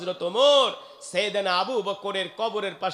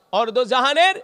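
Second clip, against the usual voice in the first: usual speaking voice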